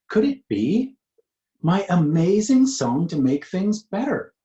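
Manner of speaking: high, loud delighted gasp of joy; thrilled she can't believe it; excited. Acted. Cat hearing her friends sing her song, excited.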